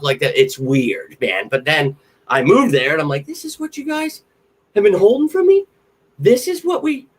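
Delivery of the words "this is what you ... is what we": very high, squeaky put-on voice; shrill mock betrayal; mock whiny outrage. Mock outrage on moving to Philly.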